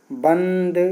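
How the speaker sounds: usual speaking voice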